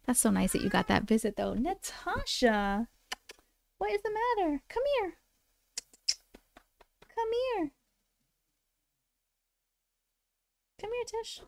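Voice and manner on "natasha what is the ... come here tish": cooing to the cat. Real, not acted. Streamer coaxing her cat Natasha to come, cooing. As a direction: coax the cat over in a high sweet voice.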